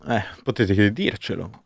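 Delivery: ordinary talk, nothing marked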